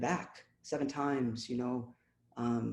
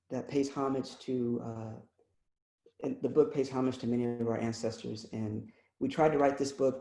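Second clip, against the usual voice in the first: ordinary talk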